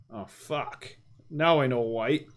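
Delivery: normal talking voice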